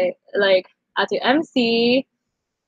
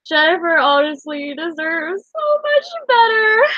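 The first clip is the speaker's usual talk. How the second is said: higher, slow, teary; tearful; 6 semitones higher, slower than usual. Real, not acted. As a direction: say it through lingering tears. Still tearful.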